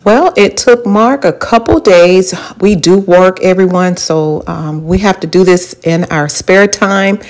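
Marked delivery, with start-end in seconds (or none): none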